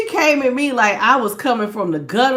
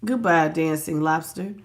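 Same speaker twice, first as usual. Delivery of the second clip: normal talking voice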